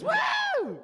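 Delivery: very high, drawn-out "woo"; celebratory whoop. Acted. Graduate cheering a drama and film degree, mock whoop.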